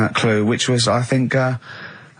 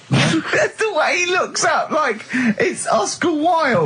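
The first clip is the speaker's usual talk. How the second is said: words squeezed out through real laughter, high and slow; can barely get it out for laughing; wheezing with real laughter; 11 semitones higher, much slower than usual. Real, not acted. Cracking up at the clue.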